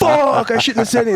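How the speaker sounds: high, pained self-rebuke; dismayed curse. Retold or imitated. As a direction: groan at your own blunder, high. Regretting speaking Russian to police.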